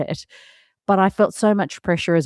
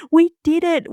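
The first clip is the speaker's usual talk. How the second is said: higher, a little louder "we did it"; triumphant; 11 semitones higher, 3 dB louder than usual. Real, not acted. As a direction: celebrate the win out loud.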